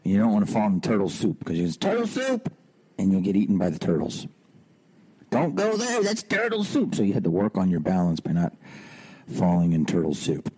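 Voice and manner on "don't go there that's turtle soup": put-on higher kid's warning voice; childish warning. Kids warning each other off the bricks, high voice. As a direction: warn like a playful child.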